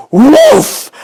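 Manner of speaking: very high, loud burst sound; loud whoosh. Acted. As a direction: blast it out like a pop. Narrator voices the pipe's burst.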